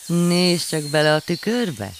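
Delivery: chuckling, nudge him to look; amused urging. A narrator's reading. Old man amused, laughing. (vidám biztatás)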